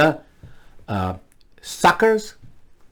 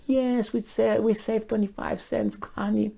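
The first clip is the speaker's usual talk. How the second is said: high, soft mock-delighted voice; thrilled penny-saving spouse; 7 semitones higher, 9 dB quieter than usual. Spouse to honey over saved cents, high mock delight. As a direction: squeal softly over a tiny saving. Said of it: "That's the way we talk".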